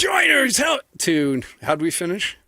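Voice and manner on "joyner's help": mock bro. Cohost mimicking the bro voice.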